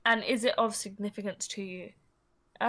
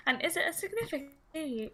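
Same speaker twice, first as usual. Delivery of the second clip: high mock-polite questioner; imagined personal-question asker; 6 semitones higher, 4 dB quieter than usual. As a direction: tiptoe through it in a put-on high voice. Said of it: "that's how I imagine they say it".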